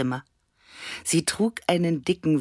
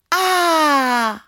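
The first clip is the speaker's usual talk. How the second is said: long, loud, high "ah"; awed "ah" cry; 14 semitones higher, 9 dB louder than usual. A narrator's reading. Annabella watching fireworks, long high awed cry. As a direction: draw out a very high cry of wonder. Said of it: "rief Annabella".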